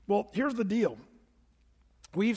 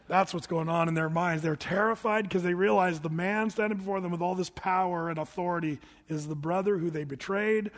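ordinary talk, nothing marked

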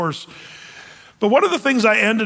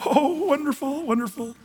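high, breathy-free mock delight trailing softer; mock gushing Christians; 7 semitones higher, 4 dB quieter than usual. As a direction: parody naive believers cooing. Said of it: "Christians are going".

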